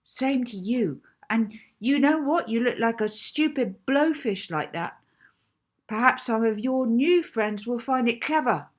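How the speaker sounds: put-on loud, high, scornful girl; angry insults. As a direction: spit the insults at him. Molly insults Rocky angrily.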